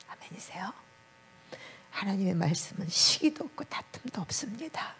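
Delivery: hushed whispered preaching. Acted. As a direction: drop to a whisper to draw the church in. (속삭이듯 낮춘 설교)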